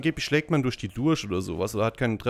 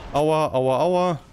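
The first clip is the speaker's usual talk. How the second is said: mock pain cries; 3 semitones higher, 5 dB louder, much slower than usual. Character hit.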